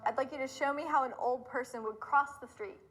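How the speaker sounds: ordinary talk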